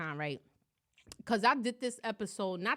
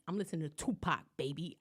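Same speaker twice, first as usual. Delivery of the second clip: a hint of a low, laid-back stranger, softer; low-key guy on the train; 4 semitones lower, 7 dB quieter, faster than usual. Imitating the train guy telling her his music, softer. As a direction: lightly voice the chill guy from the train.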